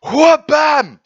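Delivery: explosive high-pitched impact cry; kick shout. Retold or imitated. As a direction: slam it down with a big cartoon shout. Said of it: "comes down and goes".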